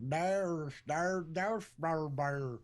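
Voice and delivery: slack-jawed hillbilly gibberish; imitated thick hillbilly mumbling; mock hillbilly drawl. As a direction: mush the words into a caricatured hillbilly drawl. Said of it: "that's where you get that whole".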